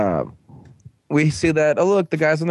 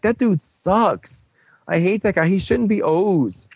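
high, slow mock griping; hammed-up whining grievance, dragged out; sulky complaint; 6 semitones higher than usual. Retelling his complaint to Kogami, sulky whine.

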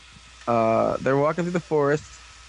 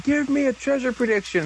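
mock yell; 11 semitones higher than usual. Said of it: "yelling at Navi".

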